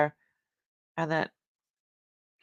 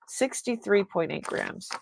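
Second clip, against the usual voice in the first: ordinary talk, nothing marked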